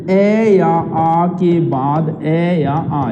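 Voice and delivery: spelled out very slowly, every vowel held; slow rule recitation. Shown as a demonstration. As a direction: dictate the rule word by word, x0.3. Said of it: "ये ऐसे बनता है आ". (नियम बहुत धीरे-धीरे बोलकर)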